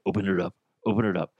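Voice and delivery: eager rushed mumble, pitched low; fast low pitch. Acted. Dick in a box pitch.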